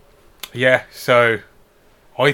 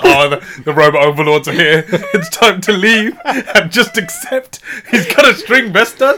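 laughing; 3 semitones higher, 6 dB louder, slower than usual. Retold or imitated. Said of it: "I actually would be like".

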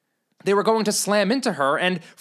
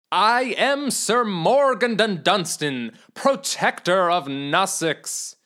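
grand self-introduction; 3 dB louder, slower than usual. Acted. Knight introduces himself grandly.

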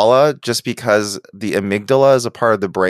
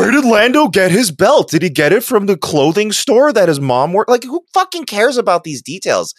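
high, mocking questions; mocking rant; 10 semitones higher than usual. Real, not acted. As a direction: scoff at the pointless details. Mocking Star Wars over-explaining.

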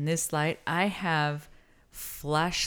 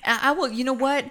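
excited; 8 semitones higher, 7 dB louder than usual. Real, not acted. Excited rant.

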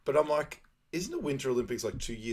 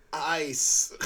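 higher, louder one-word movie impression; imitating Sanka; 6 semitones higher, 5 dB louder than usual. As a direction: mimic a comic film character's outburst. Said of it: "Sanka just goes".

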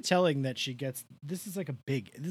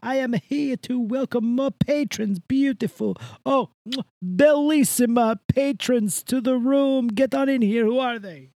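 Italian host voice; 6 semitones higher, 3 dB louder than usual. Acted. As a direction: play a gushing Italian greeter. Host character greeting new members.

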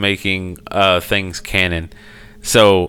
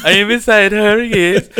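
high, louder theatrical vampire; vampire impression; 11 semitones higher, 4 dB louder than usual. Riffing on the show scene, hammed-up vampire voice. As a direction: declare it proudly. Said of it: "quoting the show".

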